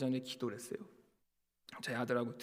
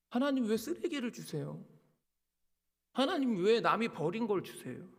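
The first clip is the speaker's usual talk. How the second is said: mock protest, pitched up; complaining to God; 7 semitones higher than usual. Disgruntled pastor questioning God's gift, acted complaint. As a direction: plead upward, demanding to know why.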